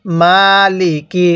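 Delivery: মালিকি with মা held long and লি কি short; stretched first syllable. Shown as a demonstration. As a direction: draw out মা, keep লিকি quick. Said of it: "বাকিগুলোর মধ্যে টান হবে না". (মালিকি: শুধু মা-তে টান, বাকিগুলো ছোট)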